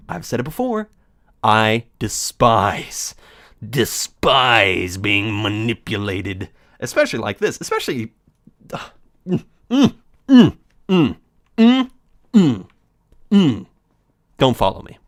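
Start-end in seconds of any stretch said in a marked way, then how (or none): 1.4-6.5 slow bitter. Acted. Angry at being manipulated. 9.7-13.7 muffled struggling hums. Acted.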